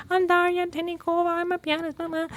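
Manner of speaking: high, shrinking nervous self-introduction; imitating her jittery younger self on camera; nervous first video. Pianist mocking her first nervous YouTube intro. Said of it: "I was like".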